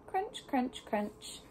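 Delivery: ordinary delivery, nothing marked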